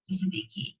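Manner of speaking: ordinary talk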